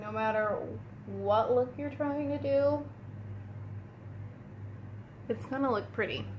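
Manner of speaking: ordinary talk, nothing marked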